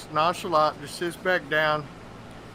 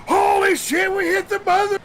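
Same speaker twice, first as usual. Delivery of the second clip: much higher, loud whoop; excited shout; 6 dB louder than usual. Retold or imitated. Friend imitating Phil thinking he hit crabs, shouting. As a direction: yell it like a big catch. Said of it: "Phil goes".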